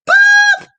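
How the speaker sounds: squeaky high boop effect; high-pitched mock sound effect. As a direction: pop a cartoon boop. Sound effect for lost client.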